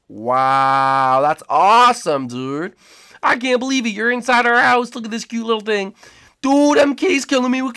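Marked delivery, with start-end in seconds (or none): none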